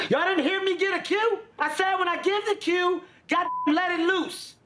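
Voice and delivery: high-pitched comic outrage; mock scolding. Host scolding the crew over a missed cue, high mock outrage. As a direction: berate them in a high, put-upon holler.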